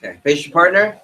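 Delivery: ordinary talk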